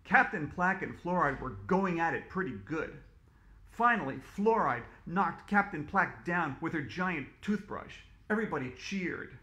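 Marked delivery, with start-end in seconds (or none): none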